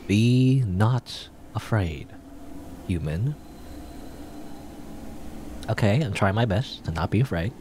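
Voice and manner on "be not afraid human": slow and dramatic. Acted. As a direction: intone it with long dramatic pauses. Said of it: "that was way too slow and dramatic".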